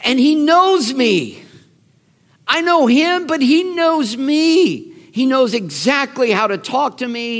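high, emphatic, acted joyful amazement; amazed realization. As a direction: marvel aloud, stretching the key words. Acts Mary's amazed realization.

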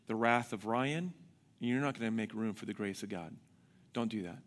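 low, firm, measured order; retold stern pastor. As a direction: imitate a pastor calmly talking someone down. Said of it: "And he's like".

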